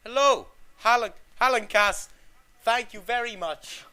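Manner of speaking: loosely imitating a TV host; Graham Norton voice. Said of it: "I can't do Graham Norton's voice".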